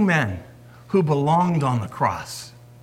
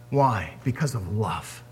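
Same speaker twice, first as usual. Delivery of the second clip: softer, lower, tender answer; 7 semitones lower, 5 dB quieter than usual. Real, not acted. Preacher answering why Jesus died, tender. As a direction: let it land gently.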